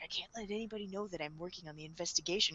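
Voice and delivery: very soft, low and fast; imitated hushed aside to self; secretive inner thought. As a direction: murmur it like a private thought. Acting Light's secret thought.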